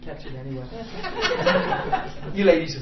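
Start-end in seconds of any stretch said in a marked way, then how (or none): 0.7-2.3 high-pitched laughter; amused laugh. Real, not acted. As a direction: laugh high at the shared joke. Amused aside to the ladies.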